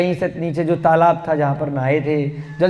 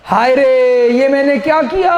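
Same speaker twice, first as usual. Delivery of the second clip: louder, higher mock lament; acted wailing; 6 semitones higher, 4 dB louder than usual. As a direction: cry out in acted grief. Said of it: "बंदरिया रोने लग गई". (पछताती बंदरिया का ऊँचा नाटकीय विलाप)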